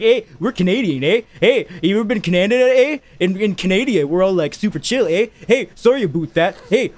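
mock Canadian moose voice; hammed-up Canadian lilt; Canadian accent. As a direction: put on a comic Canadian accent, with perky "eh" tags. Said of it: "then the moose guys are like".

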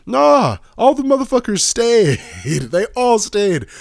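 high, loud laughing amazement; excited disbelief. Real, not acted. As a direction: blurt it out amazed. Excited disbelief at crowded gym.

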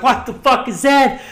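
high, loud retold disbelief; startled yell, retold; shocked outburst. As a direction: blurt it out in mock shock. Retold shock at flying screw.

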